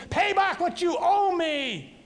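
a choking creditor's angry demand. Acted. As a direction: bark out the demand at the debtor. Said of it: "he demanded".

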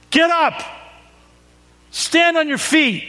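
high, firm command; commanding Jesus. Acted. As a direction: order him up with urgent authority. Jesus commands the fallen Paul.

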